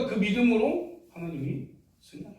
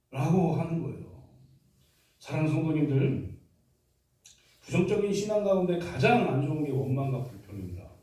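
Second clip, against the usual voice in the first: ordinary talk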